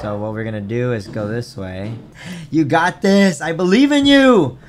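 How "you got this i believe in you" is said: loud encouraging cheer. Real, not acted. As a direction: cheer her on. Cheering on his friend.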